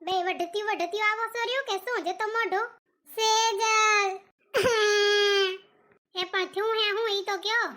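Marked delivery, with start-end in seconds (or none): 3.2-4.1 loud call across the house. Acted. Aunt calls Sejal from another room, loud call. 4.5-5.6 sob-laugh. Acted. Distressed friends arriving.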